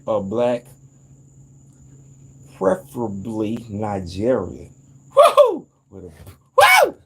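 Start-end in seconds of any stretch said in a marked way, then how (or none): 5.1-7.0 high, loud whooping; hollering woo with real excitement; excited whoops. Real, not acted. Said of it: "I'm getting excited".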